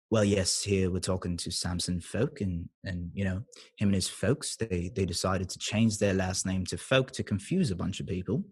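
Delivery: low, soft British Cockney chat; Cockney accent. Shown as a demonstration. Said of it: "Cockney is like regular British. So it's like".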